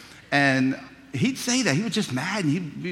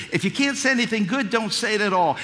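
higher, louder sermon delivery; preaching; 7 semitones higher, 3 dB louder than usual. Acted. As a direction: preach the lesson with full conviction. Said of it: "preaching lessons".

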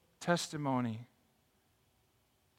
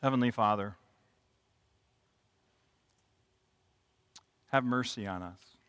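prayer; 4 semitones lower than usual. Real, not acted. Closing prayer.

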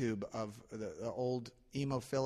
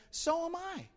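eager agreement. Retold or imitated. Joke: eager agreement with jumper.

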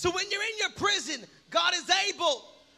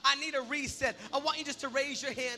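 soft, hushed prayer leading; altar call prayer; 4 semitones lower, 5 dB quieter than usual. Acted. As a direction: lead them quietly into prayer.

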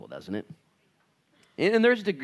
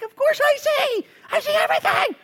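much higher, louder, emphatic; voice of the Lord; 10 dB louder than usual. Retold or imitated. The Lord answering a doubting believer, high and emphatic. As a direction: boom it out as all-seeing God. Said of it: "He's like".

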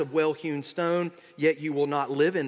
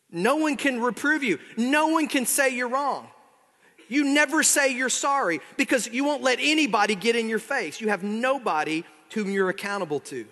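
indignant accusation; 7 semitones higher than usual. Acted. Preacher voicing the proud listener.

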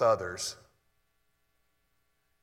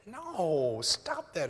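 high, drawn-out protest; emphatic objection; 5 semitones higher, much slower than usual. Real, not acted. Preacher waving off muddled pop theology, emphatic objection. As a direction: break in with a pleading, high no.

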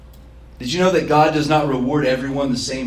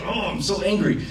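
pitched up, a playful mock of fury; mock angry whine; 6 semitones higher than usual. Preacher mocking his own phone anger, higher and playful. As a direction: whine the anger half in jest. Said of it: "You feel that".

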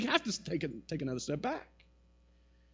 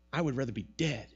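a hint of a low, sulky Jonah; lightly voiced Jonah wishing he were dead; sulking Jonah; 5 semitones lower, 6 dB quieter than usual. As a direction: mutter it low, like a sulking prophet. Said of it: "he says to God".

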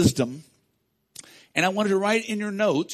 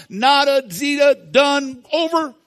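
much louder and higher, shouting each word; preaching shout; 7 semitones higher, 9 dB louder than usual. Real, not acted. Pastor telling the congregation hopes seem gone, shouting. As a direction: hammer the words out, loud and high.

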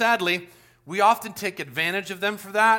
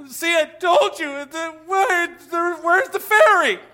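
put-on higher, louder, slow accusing child; indignant kid; 9 semitones higher, 6 dB louder, slower than usual. Boy confronting his parents about the fairy, high and indignant. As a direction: demand answers like a wronged little boy.

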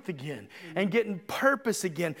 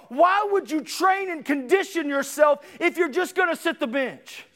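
higher, driving sermon delivery; forceful preaching; 5 semitones higher, 3 dB louder than usual. Real, not acted. Preacher urging church people off the bench, forceful preaching. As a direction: preach it hard, line after line.

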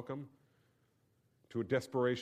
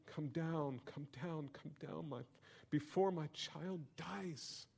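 desperate plea; 5 semitones higher, 6 dB quieter, slower than usual. Acted. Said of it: "The royal official says to him".

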